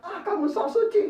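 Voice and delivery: high, laughing mock jeer; sneering "so suci" with a laugh; mocking taunt. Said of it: "mungkin kita diejek".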